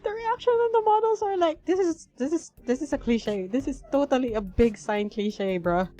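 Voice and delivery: high, loud excited rant. Real, not acted. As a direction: rant about it, thrilled. Excited commentary.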